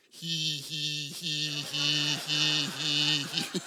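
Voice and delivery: low, hushed snickering; imitating a sneaky giggle. Preacher imitating Shant snickering across the silent room. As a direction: mimic a classmate's muffled snicker. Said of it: "all I hear ... is Shant".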